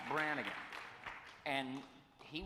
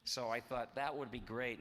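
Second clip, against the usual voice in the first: normal talking voice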